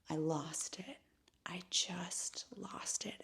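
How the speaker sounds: hushed whisper, voice almost gone. Real, not acted. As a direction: drop to a breathy whisper for the climax. Dramatic climax of story.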